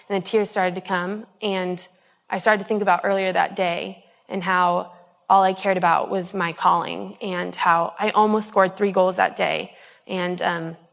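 ordinary talk

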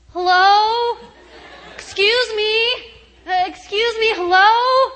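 high, sing-out call for attention; polite calling out. Retold or imitated. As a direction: call through a door, tentative but carrying. Calls out from locked bathroom floor.

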